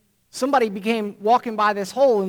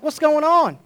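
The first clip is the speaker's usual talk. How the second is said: surprised call; 8 semitones higher, 5 dB louder than usual. Acted. Joe calls down to buddy.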